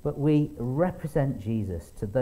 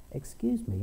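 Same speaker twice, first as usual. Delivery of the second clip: put-on little girl; 10 semitones higher than usual. Little girl voiced in story.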